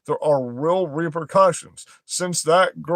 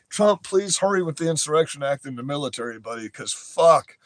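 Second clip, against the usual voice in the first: usual speaking voice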